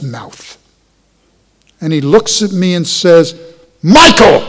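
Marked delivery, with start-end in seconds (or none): 3.8-4.4 sharp yell of Michael, higher and louder; father's yell. Retold or imitated. Father yelling at his grown son, loud yell. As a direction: bark the name like an angry father. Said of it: "in exactly that yell".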